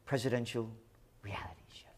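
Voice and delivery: drops to a hushed whisper on reality show; sarcastic whisper. Real, not acted. Commentator mocking the Democratic convention, whispered aside.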